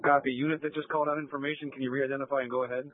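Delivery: police radio. Real, not acted. Dispatcher asking on radio.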